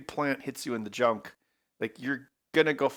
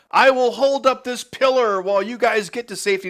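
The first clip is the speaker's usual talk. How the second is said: louder, higher hero's declaration; mock-heroic cry; 8 semitones higher, 8 dB louder than usual. Film fan voicing a doomed sidekick's rescue, mock-heroic. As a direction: call it out like a self-sacrificing hero. Acting out heroic sacrifice line.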